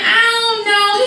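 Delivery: put-on high-pitched, sheepish kid; mock childish helplessness; child's whiny answer. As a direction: answer dad in a high whine. Child answers disappointed dad.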